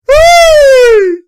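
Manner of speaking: high, loud shriek of laughter; cracking up at the play, loud shriek. Real, not acted. Huge laugh.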